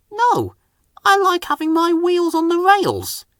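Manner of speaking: a mild sulky no; grumpy reply. Acted. Engine snubbing the helicopter, high with mild grumpiness. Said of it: "replied Percy grumpily".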